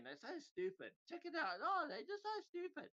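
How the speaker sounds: high-pitched mock analysts' scoffing; mocking analysts. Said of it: "analysts say".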